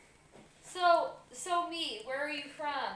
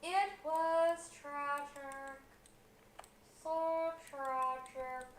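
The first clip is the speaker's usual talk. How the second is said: very slow, drawn-out mock grief; mock tragic; 3 dB quieter, much slower than usual. Co-host mourning a departed viewer, mock tragic. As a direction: stretch it out like a hammy mourner.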